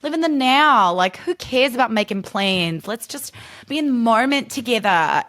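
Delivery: lightly hammed-up New Zealand accent; mock New Zealand accent. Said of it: "New Zealand accent being like".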